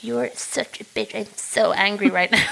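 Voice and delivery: a muttered sisterly jab, slowed down; mock snippy sister. Sister quoted in mock spat.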